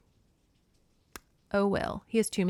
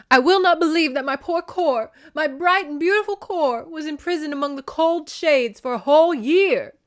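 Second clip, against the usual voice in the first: high, loud, anguished wail; grieving mother's high lament; 8 semitones higher, 6 dB louder than usual. A narrator's reading. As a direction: wail in protest, pitched way up. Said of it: "Demeter wailed".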